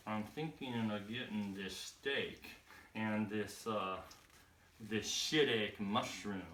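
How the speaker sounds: put-on low, soft, slow good old boy. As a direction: imitate a good old boy slowly pondering the menu. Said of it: "He's like".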